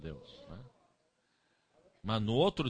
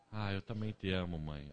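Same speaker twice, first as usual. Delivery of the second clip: tender son; 5 semitones lower, 5 dB quieter than usual. Retold or imitated.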